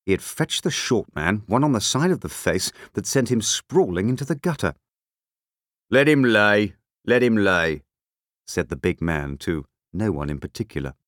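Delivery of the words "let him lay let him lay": gruff, dismissive big man; rough big man. A narrator's reading. Big man to no one in particular, roughly dismissive. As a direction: wave off the man you just floored.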